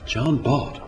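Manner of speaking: folksy storytelling verse with deliberate pauses; comic story poem recitation. Acted.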